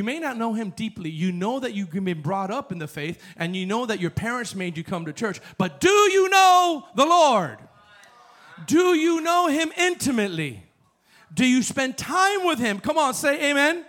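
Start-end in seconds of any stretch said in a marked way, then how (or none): 5.6-13.8 high, loud preaching; fiery preacher calling out the congregation; full-force preaching. Acted. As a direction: challenge the church at full voice.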